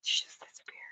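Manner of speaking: hushed whisper so neighbors won't hear. Real, not acted. Out at night, whispering so the neighbors stay asleep. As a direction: whisper it under your breath.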